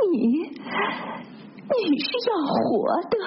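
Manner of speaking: high, slow, broken-off reproach; bitter resentment. A narrator's reading. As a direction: accuse haltingly in a high, aggrieved voice. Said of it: "他这话是表示一肚子的怨恨". (满怀怨恨地高声责备)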